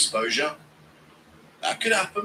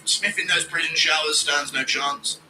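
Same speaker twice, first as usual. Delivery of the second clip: normal talking voice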